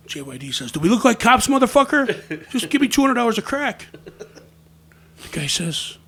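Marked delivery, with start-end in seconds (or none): 0.7-3.9 louder, higher irritated retort; mock indignant demand. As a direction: act out an impatient, loud comeback. JYD retold snapping at dealer.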